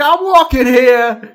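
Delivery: brash New York accent, drawn out and loud. Shown as a demonstration. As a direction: holler it like a brash New Yorker. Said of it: "New York is more like".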